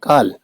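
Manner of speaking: काल for "call", with a long open vowel near आ; English "call" as काल, long open vowel. Shown as a demonstration. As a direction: open the vowel in काल wide, toward "kaal". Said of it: "क्या कहते हैं?".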